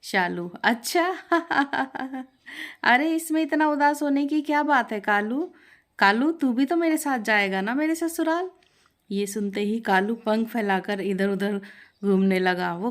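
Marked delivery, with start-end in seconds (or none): 0.6-1.1 high, amused "अच्छा"; surprised "oh". Acted. Shalu learns why parrot sad. 1.2-2.2 short amused giggle; light laugh. Acted. Shalu laughs at parrot. 2.8-8.5 bright, higher, cheering tone; cheerful reassurance. Acted. Shalu cheering up parrot.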